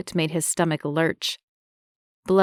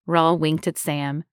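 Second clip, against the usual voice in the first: usual speaking voice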